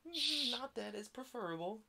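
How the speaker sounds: normal talking voice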